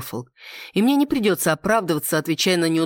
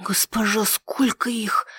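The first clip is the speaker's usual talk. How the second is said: slow, whispery, higher-voiced maid's mutter; 5 semitones higher, slower than usual. A narrator's reading. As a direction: mutter it breathlessly in a raised pitch, drawing it out. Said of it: "пробормотала Нетта".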